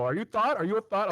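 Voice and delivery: high, loud imitation German accent; thick German accent. Pizza guy calling for Thor over the mic, mock German accent. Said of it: "in a very thick German accent".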